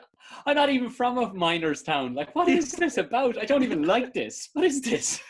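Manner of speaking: very high, loud whiny complaint; whiny bewildered voice. Retold or imitated. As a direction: squeal it like a fed-up kid dancer. Continuing the Billy Elliot bit.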